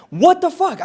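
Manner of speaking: high, indignant retold outburst; pissed off. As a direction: protest in high-pitched outrage. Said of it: "I'm, like, pissed off".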